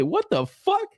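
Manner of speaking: high-pitched, re-enacted disbelief; retold incredulous outburst. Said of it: "yeah, I was tripping".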